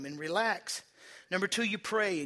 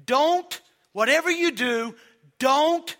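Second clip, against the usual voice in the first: loud, high don't; emphatic warning; 7 semitones higher, 11 dB louder, slower than usual. Real, not acted. Pastor warns the congregation, loud and high. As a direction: shout the warning. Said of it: "Now listen to me".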